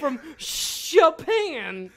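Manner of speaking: very slow, high and loud; drawn-out incredulity. Real, not acted. Laughing at the idea of floating from Japan, slow high drawn-out words. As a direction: stretch it out in mock disbelief.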